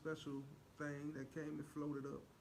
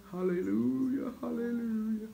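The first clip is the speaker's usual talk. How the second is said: mock church praise; 6 semitones higher, 10 dB louder than usual.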